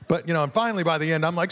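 ordinary delivery

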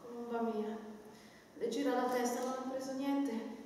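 soft, tender murmur; tender fussing. Acted. Nurse turns tender toward her tired girl, softly. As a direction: go soft and motherly, scolding yourself.

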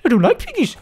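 high squeaky whiny chicken voice; voicing the chicken. Gamer voicing his chicken, squeaky and whiny. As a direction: whine like a scared little chicken. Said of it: "He's like".

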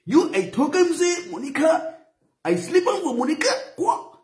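high mock Hausa accent. Shown as a demonstration. Vlogger showing how he'd fake a Hausa voice, high. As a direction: put on a high-pitched Hausa voice. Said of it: "now I wan use Hausa voice".

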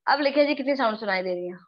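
ordinary delivery

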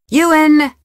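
sharp call. Acted. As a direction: call the name out to stop them. Cutting someone off sharply.

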